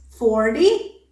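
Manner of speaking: "Forty" as for-dee, first syllable stressed; flapped t. Shown as a demonstration. Teacher contrasting two words, "Forty" with a flapped t. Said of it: "t changes into the alveolar flap".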